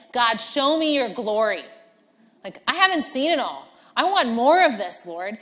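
loud, urgent plea; bold pleading. Retold or imitated. Moses boldly asking God for his glory, loud and urgent. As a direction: demand it with holy chutzpah.

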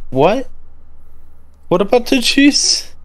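high, rising baffled question; mock-bewildered guard, voice lifted high; puzzled guard. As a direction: ask it with the pitch climbing in confusion. Guard investigating, puzzled, questions the kid.

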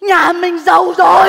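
slow, loud cry of joy; excited shout. Acted. Wife shouts to husband that they're rich. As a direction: shout the good news slowly.

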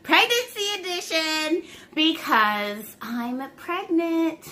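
high, slow, bright announcement; excited reveal. Real, not acted. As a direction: drag out the big news. Big reveal in intro.

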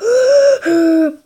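loud, high gasping breath; exaggerated breathing. Gamer calming himself after screaming, exaggerated noisy breath. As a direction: breathe noisily and high, overdone.

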